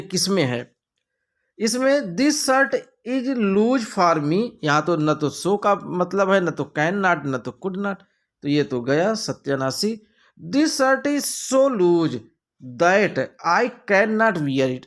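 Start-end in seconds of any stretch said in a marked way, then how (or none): 1.6-4.4 slow, higher reading of the option; slow reading aloud. Shown as a demonstration. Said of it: "reads option slowly". 10.4-14.9 slow reading aloud. Shown as a demonstration. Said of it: "reads option slowly".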